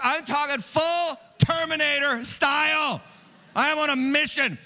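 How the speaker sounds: high, swaggering comic preaching; mock action-hero bravado. Preacher imagines himself as Jesus out for payback, mock bravado. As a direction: play up the tough talk, punching each phrase.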